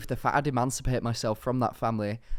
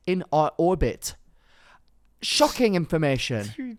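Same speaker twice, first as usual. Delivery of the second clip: ordinary delivery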